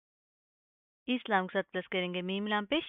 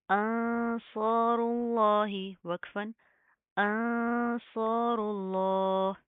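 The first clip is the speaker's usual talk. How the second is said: very slow sung tajweed recitation; Quranic recitation; much slower than usual. Shown as a demonstration. Teacher recites the verse slowly for students. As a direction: chant it slowly, drawing every vowel out. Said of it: "लफ्ज़ अल्लाह को हम मोटा पढ़ेंगे".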